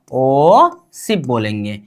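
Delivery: ओ शिप said low and drawn out; low slow demo. Shown as a demonstration. Teacher modelling the number name for pupils, low and slow. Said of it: "इसको हम बोलेंगे क्या?".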